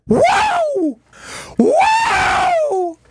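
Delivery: high shouted whoa of glee; joyful whoa. Real, not acted. Gloating to cohost about the Red Sox, shouted whoa. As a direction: whoop like the team just won.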